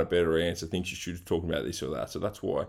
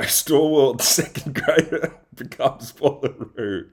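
laughing; 7 semitones higher, 6 dB louder, slower than usual. Real, not acted. Repeating the guest's line, amused.

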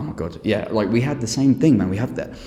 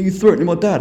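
whiny son; 9 semitones higher, 5 dB louder than usual. Retold or imitated. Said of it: "he was like".

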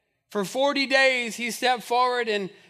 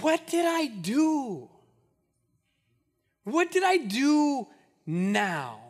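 whiny little brother; 2 semitones higher, much slower than usual. Retold or imitated. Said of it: "David's response is".

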